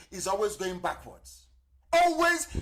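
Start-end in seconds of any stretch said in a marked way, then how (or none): 1.9-2.5 exclaimed. Real, not acted. A preacher exclaiming to his listeners, high and loud.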